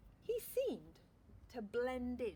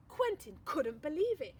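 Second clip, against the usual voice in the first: usual speaking voice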